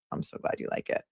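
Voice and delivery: soft unvoiced whisper; whispered gladness. Real, not acted. Streamer whispering to a viewer who loves her hoodie. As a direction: hush it out warmly.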